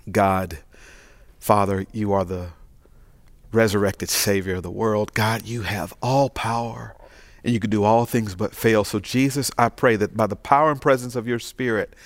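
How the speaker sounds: bow your voice before God; prayer. Acted. Pastor leads the prayer.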